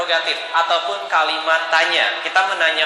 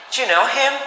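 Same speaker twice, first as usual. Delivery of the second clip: lively question, much higher on "him"; with expression; 8 semitones higher than usual. Shown as a demonstration. Teacher showing the expressive way, lively rising question. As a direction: ask it brightly like a real English speaker. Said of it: "harus pakai expression ya".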